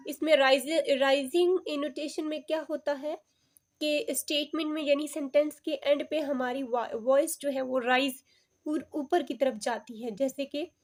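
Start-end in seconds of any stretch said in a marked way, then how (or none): none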